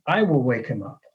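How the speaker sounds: "I" firm, falling; only I will do it; stress on I. Shown as a demonstration. Preacher restating the singular I, firmly.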